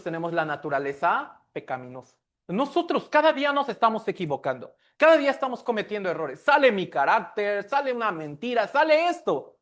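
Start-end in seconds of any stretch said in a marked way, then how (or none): 2.5-9.6 high, loud, fast fervent preaching. Acted. Pastor urging the congregation, loud fast preaching. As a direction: build the sermon up quickly.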